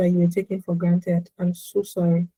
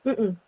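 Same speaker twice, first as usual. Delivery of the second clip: faint, soft closed-mouth mm-mm; the half-hearted exhale to avoid; weak hum; 7 dB quieter than usual. Shown as a demonstration. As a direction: hum it out timidly, barely letting air go. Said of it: "Don't just do".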